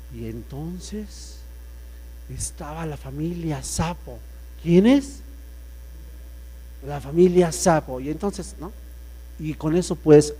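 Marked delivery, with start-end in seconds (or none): 0.1-4.2 slow, much softer storyteller voice; storytelling hush. Shown as a demonstration. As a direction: draw them into the tale, softly and slowly. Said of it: "para contar historias". (narración de cuento, lenta y en voz baja)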